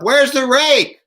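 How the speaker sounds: loud, high yell to someone upstairs; shouting up. Acted. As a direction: holler it up the stairs. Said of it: "he shouts up".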